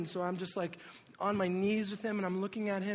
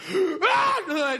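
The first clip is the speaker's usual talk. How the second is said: loud, high mock battle scream; imitated cage-fighter scream; 12 semitones higher, 11 dB louder than usual. Wife hit by a rock, imitated cage-fighter scream. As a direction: scream like an enraged fighter, hammed-up. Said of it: "I hear her scream like a cage fighter".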